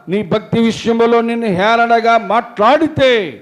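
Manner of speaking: loud, high fervent sermon voice; full-force preaching. Acted. Preacher retelling Job's friends to congregation, fervent. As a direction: preach it out with fire.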